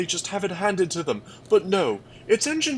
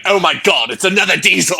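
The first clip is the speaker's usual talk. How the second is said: loud, laughing mock dismay; exasperated James; 10 dB louder, faster than usual. James groaning aloud as a new diesel arrives, loud and laughing. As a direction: throw up your hands at yet another diesel. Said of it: "James would be like".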